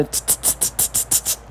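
quiet unvoiced tss tss hisses; needle hiss sound. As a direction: imitate a pin pricking, hissing softly. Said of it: "apertando várias vezes com a porra da agulha".

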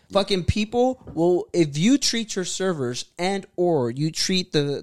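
ordinary talk

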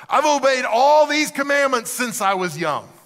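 high, loud and drawn-out eagerness; proudly excited, voice lifted high; excited young man. Acted. Rich young man answering Jesus about the commandments, excited. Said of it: "You can almost hear the excitement".

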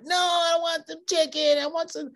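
put-on high, childish shout of refusal; whiny kid refusal. Young Paul refusing his family's food, high whiny shout. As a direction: whine like a picky kid who only wants chicken.

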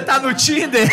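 drawn-out question through real laughter; laughing tease. Real, not acted. Host teasing someone about being on Tinder, laughing hard.

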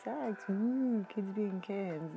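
high, drawn-out mock cheering; hammed-up gushing fan; mock excitement. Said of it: "people are all like".

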